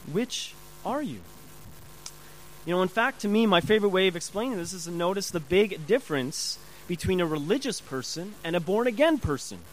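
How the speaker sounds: usual speaking voice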